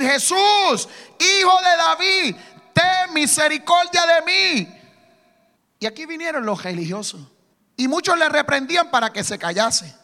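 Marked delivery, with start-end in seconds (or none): 0.0-4.8 loud, slow desperate plea; loud pleading cry of a blind beggar. Retold or imitated. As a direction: cry out for mercy, drawn out. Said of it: "comenzó a dar voces y a decir".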